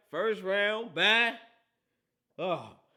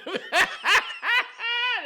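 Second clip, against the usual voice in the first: loud, high-pitched burst of laughter; high cackling laugh; 8 dB louder than usual. Real, not acted. Host laughing at Cowboys fans, loud high cackle. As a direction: howl with laughter.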